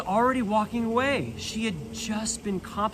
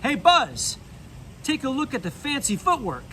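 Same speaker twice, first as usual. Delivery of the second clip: called out across the room, higher; calling out; 7 semitones higher than usual. Acted. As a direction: hail him from across the room. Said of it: "Slinky Dog called out".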